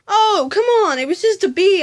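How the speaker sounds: high, loud mimicry of a scoffer; mocking dismissal. Classmate brushing off the dead bee, mockingly imitated. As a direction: imitate someone brushing it off, exaggerated.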